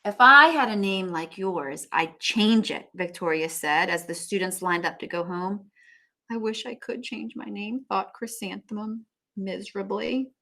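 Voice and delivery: read-aloud storybook voice; story narration. A narrator's reading. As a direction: read on to the class. Teacher reads book aloud.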